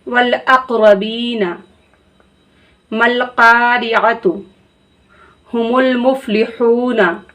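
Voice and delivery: slow, measured recitation with long pauses; slow Quranic recitation. Acted. Teacher reviews all the phrases in slow recitation. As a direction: recite each phrase deliberately, pausing between.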